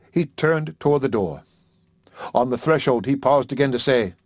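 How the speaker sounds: plain reading voice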